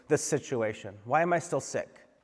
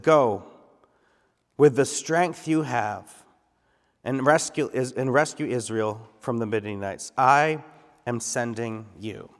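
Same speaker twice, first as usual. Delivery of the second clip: slow, weighty reading of God's command; solemn slowed scripture read; slower than usual. Acted. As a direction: give each word weight like a proclamation. Preacher reads God's words to Gideon.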